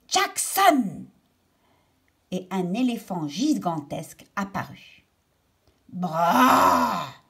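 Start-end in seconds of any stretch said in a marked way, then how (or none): 0.1-1.0 called shout. Acted. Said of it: "Il dit". 5.9-7.2 a louder mock elephant trumpet. Elephant threatening the girl with a mock trumpet. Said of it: "fit l'éléphant en agitant sa trompe".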